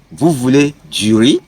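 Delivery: "riz" lifted into a question; rising question tone. Shown as a demonstration. Teacher repeating a French example, rising question tone on "riz". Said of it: "you can just raise your tone".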